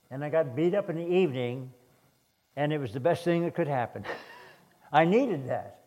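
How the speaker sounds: usual speaking voice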